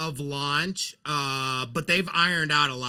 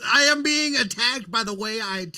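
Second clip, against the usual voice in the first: mock indignation; 6 semitones higher than usual. Teased over how he says golf.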